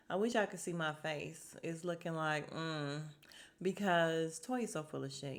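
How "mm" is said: usual speaking voice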